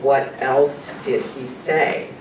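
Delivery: very slow WHAT ELSE DID HE SAY, word by word; slow, weighted question. Retold or imitated. Fiancée who already knows, asking slowly after a pause. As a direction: press for the answer, spacing out each word. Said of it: "there's this long pause".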